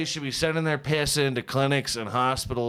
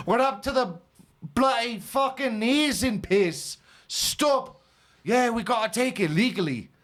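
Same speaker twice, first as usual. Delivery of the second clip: much higher, hammed-up Scottish accent; mock Scottish accent; 6 semitones higher than usual. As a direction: keep up the mock Scot rant about the piss. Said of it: "that's falling apart".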